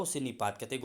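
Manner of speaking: usual speaking voice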